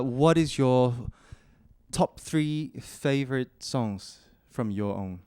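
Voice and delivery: ordinary talk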